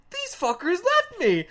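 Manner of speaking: high, loud imitated indignation; getting upset. Storyteller imitates friend realising he was abandoned, upset high yell. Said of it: "he started getting upset".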